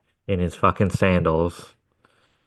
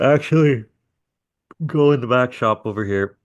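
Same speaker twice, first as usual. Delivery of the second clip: calling out; 6 semitones higher than usual. Retold or imitated. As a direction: call it over to the driver. Calling over to the driver.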